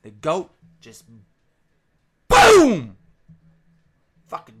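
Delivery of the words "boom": yelled boom, much higher and louder; storyteller shouting the goat's attack; sudden boom. Acted. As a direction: blast it out like an explosion. Goat attacks the wife.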